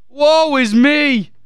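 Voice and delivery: a very slow, hammed-up wail of self-pity; mock lament. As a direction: drag it out like a melodrama. Mock lament over veto.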